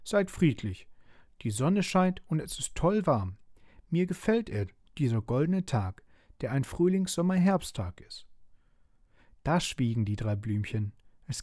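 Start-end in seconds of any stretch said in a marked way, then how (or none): 0.0-8.3 put-on higher, lilting child-figure voice; lifted storybook voice, mildly pleading; peacemaking appeal. A narrator's reading. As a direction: appeal to them to get along. Mia appeals to squabbling flowers.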